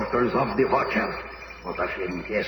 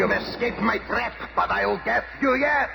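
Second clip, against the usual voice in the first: villain's rage; 7 semitones higher than usual. Acted. The Red Ghost raging at the escaped Torch, furious. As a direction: snarl it out at the hero who slipped away.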